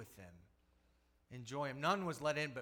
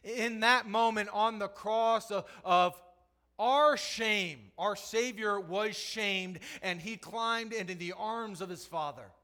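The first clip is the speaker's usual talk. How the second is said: higher, louder passionate preaching; full-force preaching; 7 semitones higher, 11 dB louder, slower than usual. Acted. Preacher urging the congregation about the cross, full-force preaching. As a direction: drive the point home from the pulpit.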